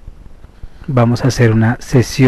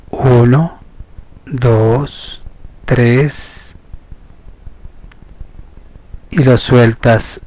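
slow, measured hypnosis voice with long pauses; guided meditation; slower than usual. Acted. Therapist leading listeners into hypnosis through slow breathing, measured meditation voice. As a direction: guide them inward, stretching the counts and key words.

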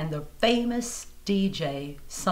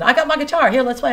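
much louder, higher urging voice; eager friend; 7 semitones higher, 11 dB louder, faster than usual. Retold or imitated. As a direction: push her to grab her guitar. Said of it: "He said".

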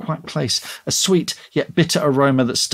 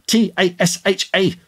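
high, emphatic letter-by-letter spelling; mild mock scream; 8 semitones higher than usual. A narrator's reading. Tasha spelling her name at Gary, exasperated mild scream. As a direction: spell it out in raised, exasperated tones. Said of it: "she screamed".

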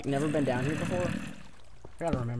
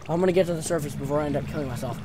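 fast, urgent rushing; 2 semitones higher, 6 dB louder, faster than usual. Real, not acted. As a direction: rattle it off to escape. Rushes to escape.

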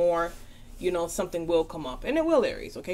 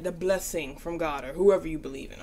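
ordinary talk

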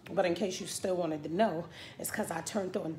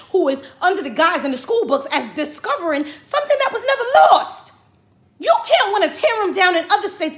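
high, loud, heated tirade; furious rant; 8 semitones higher, 11 dB louder than usual. Real, not acted. Scolding the governor Andrew over the statue, furious. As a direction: let him have it, full force. Ranting at Andrew about Columbus.

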